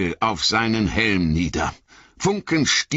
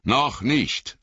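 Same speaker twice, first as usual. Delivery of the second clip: Gandalf; 7 semitones higher than usual. A narrator's reading. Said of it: "sagte Gandalf".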